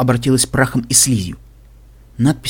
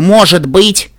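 МОЖЕТ БЫТЬ pushed higher and louder; stress on МОЖЕТ БЫТЬ; 6 semitones higher, 6 dB louder, much slower than usual. A narrator's reading. Heroine cautions Velvet there is only a chance, stressing МОЖЕТ БЫТЬ. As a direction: lean hard on "может быть". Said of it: "я подчёркиваю".

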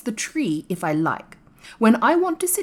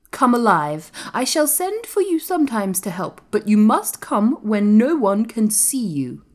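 higher, commanding proclamation; firm, imperious, voice raised; announcing; 5 semitones higher, 4 dB louder than usual. A narrator's reading. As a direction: give orders like a young master. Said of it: "announced Colin".

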